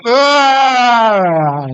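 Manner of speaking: long, loud wailing scream, acted; hammed-up scream of a sudden drop; falling scream. Rider plunging from the tower, long loud mock scream. Imitates scream as the ride drops.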